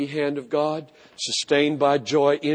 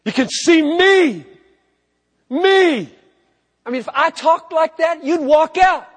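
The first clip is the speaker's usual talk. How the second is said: much higher and louder, a mock preacher showing off; self-centered preacher; 8 dB louder than usual. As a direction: play a vain preacher demanding all eyes. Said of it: "nothing could be more self-centered for Jesus to say".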